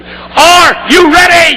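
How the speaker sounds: high, loud, slow rallying shout; stirring the assembly, loud; shouted again, stronger. Shown as a demonstration. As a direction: rouse the room with it. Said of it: "Let me try that again".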